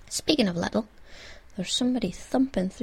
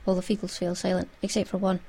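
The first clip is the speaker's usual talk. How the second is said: ordinary delivery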